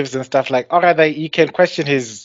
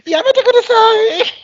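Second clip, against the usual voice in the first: put-on high cute anime girl; 3 dB louder than usual.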